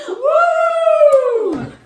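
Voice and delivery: long, loud, high "woo"; celebratory whoop. Acted. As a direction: let out a sustained high whoop. Teacher cheers as class ends.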